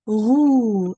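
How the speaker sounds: "roux" loud and lengthened, falling on the oo; silent x. Shown as a demonstration. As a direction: say "roux" firmly in French, no x at the end. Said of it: "you don't pronounce that X".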